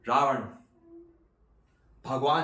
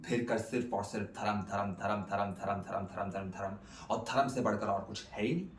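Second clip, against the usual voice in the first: dismissive repetition; 5 semitones lower, 7 dB quieter, faster than usual. Acted. Mocking the defense's repeated refrain.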